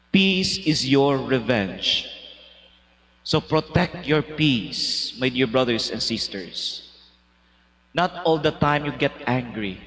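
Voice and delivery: slowly, with weight, each phrase set apart; slow solemn exhortation. Acted. Priest closing his homily to the congregation, slow and solemn. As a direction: press the lesson home, unhurried.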